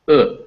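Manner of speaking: "Uh" as the vowel in could; relaxed short oo. Shown as a demonstration. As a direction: say "Uh" short and lax, as in should. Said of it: "more relaxed sound".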